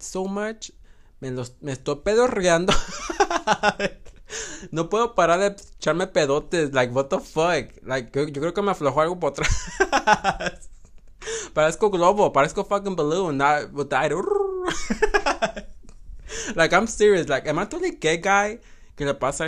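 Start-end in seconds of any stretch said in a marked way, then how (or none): 2.8-4.7 very high burst of laughter; high shrieking laugh. Real, not acted. Laughs about farting. 9.7-11.5 rising high laughter. Real, not acted. 14.1-16.5 very high long laughter; shrieking laugh. Real, not acted. Laughs hard.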